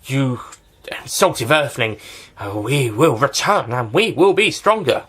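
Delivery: slow, drawn-out mock villain threat; put-on villain voice, stretched out; acted villain quote. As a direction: declaim it like a hammy alien villain. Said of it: "this guy's just like".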